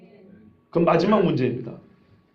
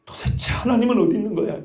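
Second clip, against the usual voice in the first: normal talking voice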